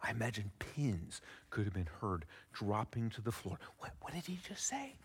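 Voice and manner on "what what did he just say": hushed and breathless, half to a neighbour; stunned whisper. Retold or imitated. Onlooker stunned by forgiveness, whispering to a neighbour. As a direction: whisper it like the room just went silent.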